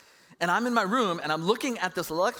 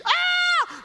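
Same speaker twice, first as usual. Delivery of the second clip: loud high startled shriek; mother screaming at her son's bleeding hand; mom's retold shriek; 9 dB louder than usual. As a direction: shriek in shock at the blood. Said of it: "She freaks out".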